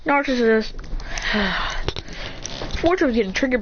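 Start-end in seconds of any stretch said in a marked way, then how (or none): 0.8-2.8 long, soft breathy sigh. Retold or imitated. Naruto reacting to Shinki's words, long sigh. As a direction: sigh it out at length.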